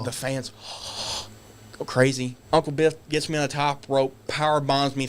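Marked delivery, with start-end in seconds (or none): 0.6-1.2 crowd gasp. Retold or imitated. Retelling fans reacting.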